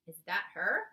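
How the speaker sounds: ordinary delivery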